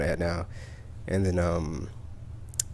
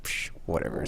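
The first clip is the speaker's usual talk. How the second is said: soft, scoffing psh, whatever; dismissive scoff; 3 semitones lower, 7 dB quieter than usual. Retold or imitated. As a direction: brush it off under your breath. Dismissing friend's excuse.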